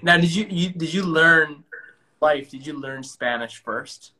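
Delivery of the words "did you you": usual speaking voice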